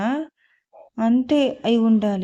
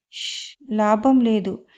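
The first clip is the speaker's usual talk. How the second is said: plain reading voice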